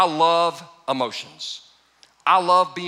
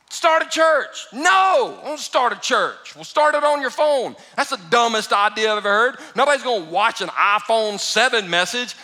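arguing with God; 6 semitones higher, 5 dB louder than usual. Retold or imitated. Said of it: "I fought him the entire time".

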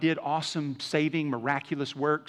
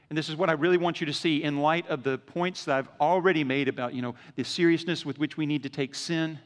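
ordinary talk

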